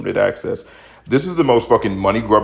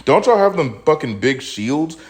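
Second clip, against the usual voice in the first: high, slow, incredulous question; exasperated disbelief; 7 semitones higher, slower than usual. Retold or imitated. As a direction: ask it like it's obvious. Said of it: "in my mind, I'm like".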